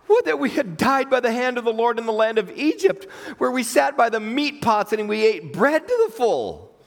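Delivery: high-pitched dramatic wailing complaint; hammed-up lament, drawing out the end; grumbling complaint. Hungry Israelites grumbling to Moses and Aaron, high dramatic complaint.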